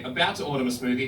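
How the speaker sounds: ordinary delivery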